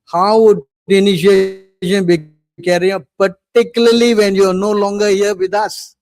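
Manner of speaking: loud, emphatic quoted question; loud reading of the disciple's question. Retold or imitated. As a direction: call the disciple's question out to the hall. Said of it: "Prabhupada said in the conversation".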